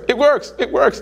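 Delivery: high, whiny it works; mock whiny insistence. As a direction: insist like a stubborn student. Student insisting, imitated.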